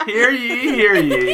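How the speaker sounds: proclaiming town crier voice. Pretend. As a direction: call out like a herald. Mock announcement at assembly.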